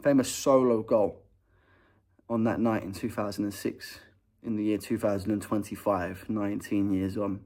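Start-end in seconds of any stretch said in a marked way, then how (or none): none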